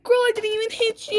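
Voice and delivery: high, loud, mocking disbelief; mocking scoff. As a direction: laugh at her overreaction. Mocking girl who cried ow.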